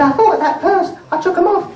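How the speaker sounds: put-on high-pitched little girl voice; little daughter. Five-year-old answering mum back, high child voice. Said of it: "She said".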